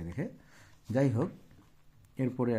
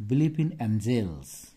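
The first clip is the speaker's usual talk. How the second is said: very slow, word by word; slow dictation; 4 dB louder, much slower than usual. Shown as a demonstration. Teacher dictating English for notes, slow and even. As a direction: spell it out slowly for students to write. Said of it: "teacher dictating English slowly".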